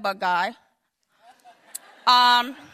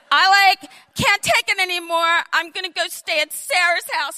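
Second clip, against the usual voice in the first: fed-up daughter; 6 semitones higher, 5 dB louder than usual. Retold or imitated. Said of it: "she goes".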